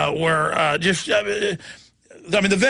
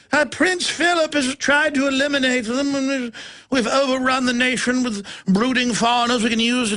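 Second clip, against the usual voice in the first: mock royal voice; 2 semitones higher than usual. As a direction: play the haughty royal looking down. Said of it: "the victor's like".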